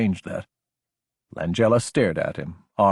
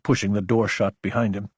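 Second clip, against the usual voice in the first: ordinary delivery, nothing marked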